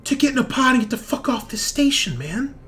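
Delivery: high, loud, exasperated retort; frantic henchman. Acted. Frantic NPC answers player.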